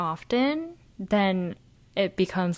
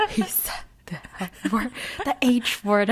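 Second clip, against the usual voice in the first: mother's little fit; 3 semitones higher than usual. Retold or imitated. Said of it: "threw a little fit in the past".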